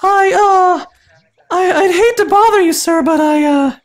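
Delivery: put-on high, halting girl voice; nervous girl voice. Nervous woman asking Shrek for help, high stammering girl voice. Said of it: "I'm gonna do some girl voices".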